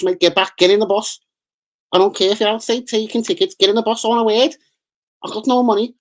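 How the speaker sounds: high, put-on Scouse menace; hammed-up Scouse hard man; Toxteth accent. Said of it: "my Toxteth brother that did start speaking".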